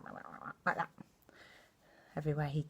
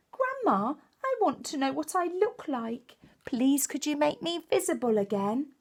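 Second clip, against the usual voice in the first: put-on a little higher, pleading child; lightly voiced child possum; 9 semitones higher, 3 dB louder than usual. Little possum Hush asking Grandma Poss, lightly pleading. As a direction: ask grandma sweetly. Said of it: "Hush said".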